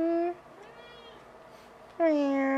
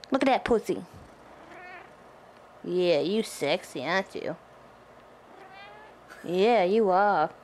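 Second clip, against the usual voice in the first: mock sultry; 9 semitones lower than usual. Flirty talk to the cat.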